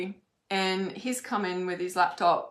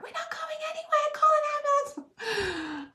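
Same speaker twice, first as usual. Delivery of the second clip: high panicked call for an ambulance; panicked cry. Retold or imitated. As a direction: shriek out the order in alarm. Retelling panic over injured leg.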